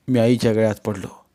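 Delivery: plain reading voice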